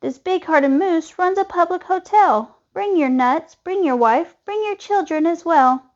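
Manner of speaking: high, inviting woodpecker call. A narrator's reading. Woodpecker invites the squirrel family into the moose's horns, calling out. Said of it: "called the woodpecker".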